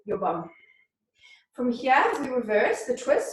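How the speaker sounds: ordinary talk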